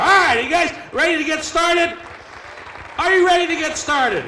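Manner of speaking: shouted hype to the audience; crowd hype shout. Real, not acted. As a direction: pump up the crowd. Hyping audience.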